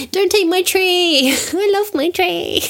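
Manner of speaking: high, slow little-creature voice; cute pet voice. Pretend. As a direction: speak for the tiny hamster, sweetly and slowly. Voicing the pet hamster.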